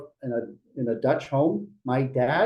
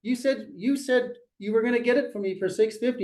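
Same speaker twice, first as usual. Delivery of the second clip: high, indignant mock complaint, pitched up; mock angry client; 7 semitones higher than usual. Client scolding agent, high, mock indignant. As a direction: voice the upset client pushing back at the agent. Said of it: "they're mad".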